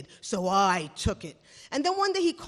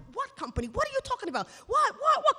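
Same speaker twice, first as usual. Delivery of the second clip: rising, much higher confused questions; bewildered; 13 semitones higher, faster than usual. Retold or imitated. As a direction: sputter in disbelief. Confused protest to boss.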